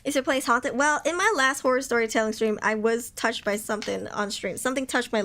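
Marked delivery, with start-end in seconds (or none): none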